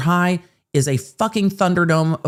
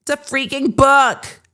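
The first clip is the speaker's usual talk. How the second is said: high, drawn-out mock protest; mocking defendant impression; 9 semitones higher than usual. Defendant insisting it's a book, mocking protest. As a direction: mimic exasperated insistence, pitched up and slow.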